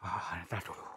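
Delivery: hushed whispered hold-on. Real, not acted. Ghost hunter whispering to his crew to wait. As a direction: hold them back in a whisper.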